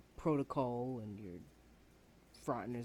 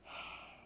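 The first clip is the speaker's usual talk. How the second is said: heavy sigh; 10 dB quieter than usual. Acted. Student alone after outburst.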